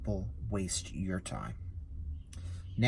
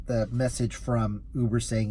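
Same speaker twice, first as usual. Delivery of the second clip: ordinary delivery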